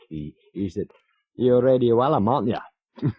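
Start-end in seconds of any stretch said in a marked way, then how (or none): 1.3-3.1 higher, louder rough go at Yorkshire; attempted Yorkshire accent. Local man challenges a radio presenter in the pub, mock Yorkshire accent. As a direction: have a stab at a Yorkshire accent. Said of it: "a Yorkshire accent".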